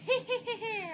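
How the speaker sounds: high, shrill witchy cackle; witch cackle. Retold or imitated. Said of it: "She just went around going".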